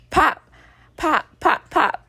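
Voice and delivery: high, loud pop pop pop; slap sounds. Retold or imitated. Showing viewers how the hits landed, sharp high pops. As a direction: mimic each slap landing. Imitating the slaps landing.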